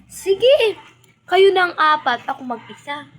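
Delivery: loud, high defiant dare fading at the end; defiant shout. Retold or imitated. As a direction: dare them all at once, loudly. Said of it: "Sabi ko". (palabang sigaw)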